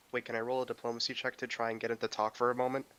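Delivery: ordinary talk, nothing marked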